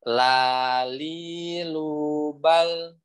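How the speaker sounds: "lali lubal" very slowly, each lam clear and light; drawn-out recitation demo. Shown as a demonstration. Teacher showing students how to practise lam, slow demo. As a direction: stretch out "lali lubal" syllable by syllable. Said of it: "nih prakteknya".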